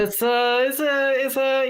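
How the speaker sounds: hammy fake Italian accent, high; mock Italian accent warming up; bad Italian accent. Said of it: "the worst Italian accent ever".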